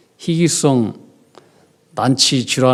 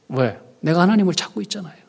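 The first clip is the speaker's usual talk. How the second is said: normal talking voice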